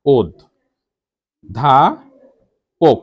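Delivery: ওদ, ধা, পোক with rounded o, pauses between; careful dictation, o sound in ওদ and পোক; syllable-by-syllable pronunciation. Shown as a demonstration. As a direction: break it into ওদ ... ধা ... পোক. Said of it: "আমরা তো অধ্যাপক বলি না".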